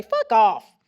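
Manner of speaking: a loud, clipped British speech machine saying "Fuck off". Retold or imitated. Eye-typed device swearing at her husband in a British accent. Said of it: "comes out in the greatest British accent".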